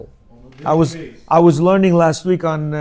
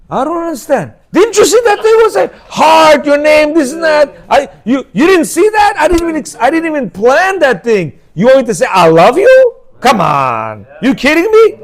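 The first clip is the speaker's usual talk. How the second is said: high, loud mock-outraged husband; mock outraged shout; 8 semitones higher, 4 dB louder than usual. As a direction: yell it as the clueless husband. Mocking the clueless man, shouting.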